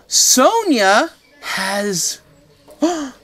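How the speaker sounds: gasping, high, loud outcry; shocked gasp. Acted. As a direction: gasp then shout in disbelief. Trixie shocked by duplicate bunny.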